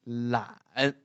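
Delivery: long "lan" dipping then rising; third tone. Shown as a demonstration. Teacher reads the syllable, slow dipping third tone. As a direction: bend "lan" down and back up slowly. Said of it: "the third tone".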